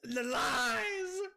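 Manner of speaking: slow, high, drawn-out wail; scream. Real, not acted. Streamer tells friends he was lied to, screaming. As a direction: scream it in betrayed protest. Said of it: "That scream just made Summer jump".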